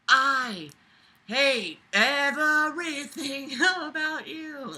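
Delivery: slow, high line broken by a laugh; laughing through it. Real, not acted. Reader laughing while mocking the writer's hate. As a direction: crack up while saying it.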